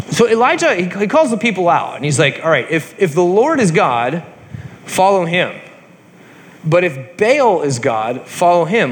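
loose, chatty storyteller voice; casual retelling. Acted. Teacher retells Elijah's challenge to the class, chatty. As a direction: tell it like talking to friends.

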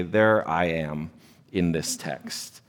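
soft, slow self-mocking aside; quiet aside. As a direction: drop low and slow, admitting it. Confessing quietly about himself.

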